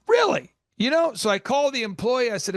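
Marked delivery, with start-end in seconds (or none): 0.1-0.5 high mock-incredulous really. Owner to customer, mock-incredulous really. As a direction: feign shock, voice jumping up. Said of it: "And I go".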